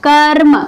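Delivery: कर्म with the र sounded firmly before म; demonstrated pronunciation. Shown as a demonstration. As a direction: say kar-ma, rolling the r in कर्म. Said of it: "इसका उच्चारण क्या करते हैं". (कर्म में म से पहले र स्पष्ट बोलें)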